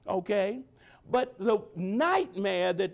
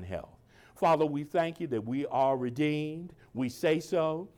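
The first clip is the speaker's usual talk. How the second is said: lower, hushed prayer voice; closing prayer; 7 semitones lower than usual. Acted. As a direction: pastor closing the service in low prayer.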